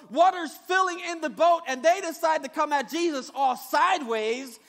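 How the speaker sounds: ordinary talk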